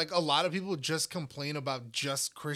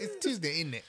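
put-on British accent; 2 semitones higher than usual. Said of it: "you have to do a British accent".

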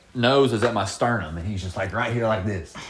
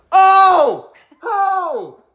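high loud shocked oh; shocked crowd cry; 8 dB louder than usual. Retold or imitated. Teammate on the sideline reacting to the knockout, shocked. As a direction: cry out like a stunned onlooker. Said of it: "Will over there to the side goes".

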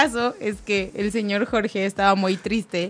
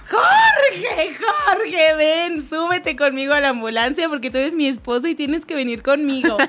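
mock desperate shouting; 4 semitones higher than usual. Retelling a wife yelling for her husband from the ambulance, mock shouting. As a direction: imitate a wife calling out, half laughing. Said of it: "le gritaba".

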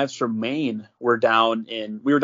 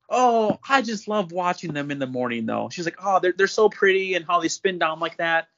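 higher-pitched, lightly acted lady gushing; 5 semitones higher than usual. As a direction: coo over them in a raised, ladylike pitch. Voices a delighted homeowner gushing.

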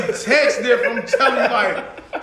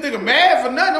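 usual speaking voice

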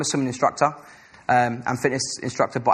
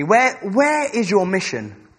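voice of the Lord; 7 semitones higher, 3 dB louder, slower than usual. Retold or imitated. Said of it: "the Lord said to me".

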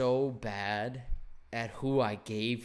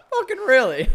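high, loud laughing outburst; laughing disbelief; 14 semitones higher, 13 dB louder than usual. Retold or imitated. Laughing at name-dropping customer.

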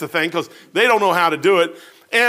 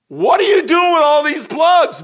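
amazed shout; 10 semitones higher, 6 dB louder than usual. Retold or imitated. Amazed at toolbox of plugs.

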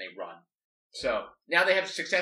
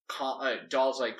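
usual speaking voice

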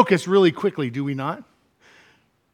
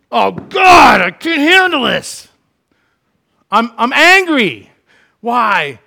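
higher, louder frustrated complaining to God; mock angry outburst; 6 semitones higher, 5 dB louder than usual. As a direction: ham up a believer's angry rant at God. Acted frustrated believer crying out.

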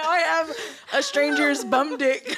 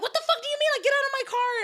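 high, panicked disbelief; retold alarmed protest, high; 13 semitones higher, much faster than usual. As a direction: demand he get out, pitched up. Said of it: "and I'm like".

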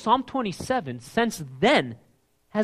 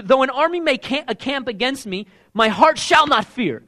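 louder, higher, bold and cocky; with attitude; 7 semitones higher, 6 dB louder than usual. Retold or imitated. Preacher voicing King David's defiant psalm with attitude. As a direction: read it like a dare. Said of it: "You gotta read King David with attitude".

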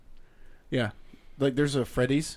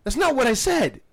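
exasperated shout; 10 semitones higher, 7 dB louder than usual. Retold or imitated. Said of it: "And I was like".

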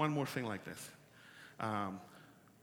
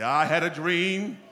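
slow, louder drawn-out claim; mock solemn claim; 4 semitones higher, 9 dB louder, slower than usual. Shown as a demonstration. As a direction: say it like a grand revelation. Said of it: "Now we do like this way".

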